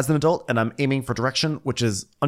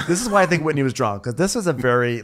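normal talking voice

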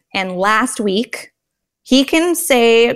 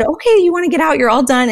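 put-on high, sing-song reassurance to her baby; bright mom voice to toddler; 6 semitones higher, faster than usual. Mom reassures her toddler in the high chair, high and bright. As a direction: lift the voice way up, cheerful and soothing. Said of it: "So I said".

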